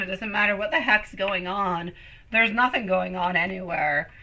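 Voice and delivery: ordinary talk, nothing marked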